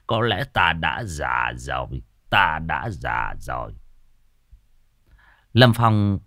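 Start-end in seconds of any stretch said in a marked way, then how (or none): 0.1-3.7 put-on slow, low muttering old man. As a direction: mumble it like a tired elder. Old master mutters to himself.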